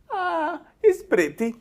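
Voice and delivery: very high-pitched naive foreigner impression; Balky. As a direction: squeak it out like a clueless sitcom immigrant. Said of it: "And Balky goes".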